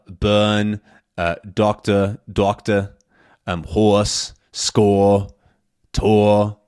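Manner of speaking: "doctor" as docta, "score" as sco-uh. Shown as a demonstration. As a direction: drop the R in "burn", "horse", "score", "tour". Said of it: "we get the same R sound".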